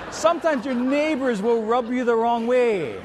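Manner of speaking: talking through laughter; words carried on a laugh. Real, not acted. Preacher still laughing after his mango joke, laughing.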